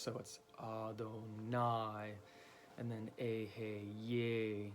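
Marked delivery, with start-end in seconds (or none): 0.6-2.1 Adonai very slow, "ah-doh-NAI"; stress on last syllable. Shown as a demonstration. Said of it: "it's gonna be the last". 3.2-4.7 Ehyeh very slow, "ay-hay-YAY"; stress on last syllable. Shown as a demonstration. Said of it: "put emphasis on that last syllable".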